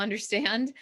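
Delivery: ordinary delivery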